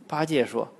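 ordinary delivery, nothing marked

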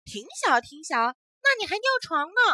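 high, loud mocking disbelief; disbelieving taunt. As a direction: tease him, not buying it. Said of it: "小翠不相信".

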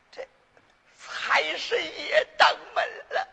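gleeful. Acted. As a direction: savour the windfall with a high, drawn-out glee. 掌柜盘算后暗喜发财. (喜出望外，拖着长腔)

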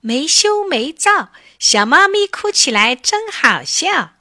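high, teasing sing-out; gleeful taunt from a little mouse; mocking mouse. A narrator's reading. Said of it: "一只老鼠正在笑它".

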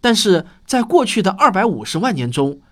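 ordinary talk, nothing marked

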